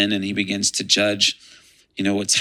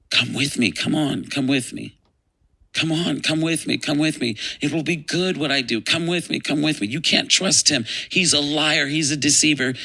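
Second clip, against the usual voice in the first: higher, repeated insistent pleading; urgent coaxing; 7 semitones higher than usual. Retold or imitated. Lucifer holding his hands out to Michael, urgently pleading. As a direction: coax urgently, pressing again and again.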